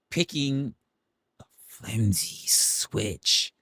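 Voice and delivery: slow, whispered aside. Real, not acted. Streamer whispering about a flimsy switch. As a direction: breathe out the words.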